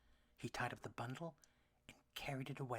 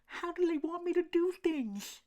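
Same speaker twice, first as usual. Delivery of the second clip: a small worried duck, pitched up; duckling voice; 12 dB louder than usual. A narrator's reading. Elmer asks.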